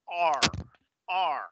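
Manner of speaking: "R" as English ar; curled-tongue sound. Shown as a demonstration. Teacher modelling English sound, curled-tongue ar. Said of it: "tenemos el sonido".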